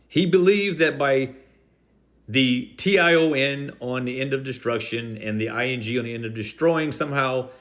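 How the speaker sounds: ordinary talk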